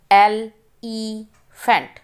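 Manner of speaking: Elephant said slowly, syllable by syllable; slow syllable reading. Shown as a demonstration. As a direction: break elephant into slow parts for pupils. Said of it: "Read the multi-syllable words".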